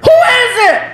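high, loud, wary shout; high loud call. Acted. Neighbor shouting through the hotel door, high wary call. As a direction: bark it at the door.

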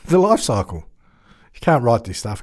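usual speaking voice

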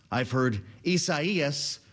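Isaias said slowly as ee-sah-EE-us; four drawn-out syllables in Isaias, stress on the EE; variant pronunciation. Shown as a demonstration. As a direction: open Isaias on "ee", then sah-EE-us. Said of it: "I've heard four different pronouncers on this storm".

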